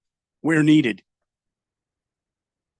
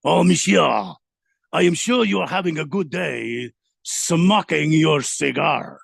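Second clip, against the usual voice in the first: bad French accent; 4 dB louder, slower than usual. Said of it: "I can't do French".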